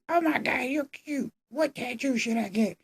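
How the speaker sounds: very high, soft, breathy-sounding gush; a hammed-up smitten teen; swooning mock Emma.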